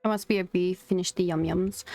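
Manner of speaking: usual speaking voice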